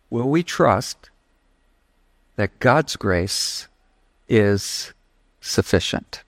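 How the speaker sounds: slow and low, the last word fading almost to a whisper; slow searching question. Real, not acted. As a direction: let the question sink in slowly, the end nearly breathed. Closing question, slowed and softened.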